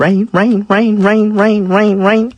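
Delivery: high repeated echo of a word; echo effect. Pretend. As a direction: mimic an echo delay. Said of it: "That's your delay".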